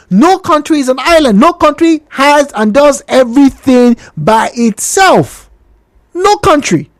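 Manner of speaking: driving home No country; emphatic insistence. Real, not acted. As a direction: hit No each time, rising and firm.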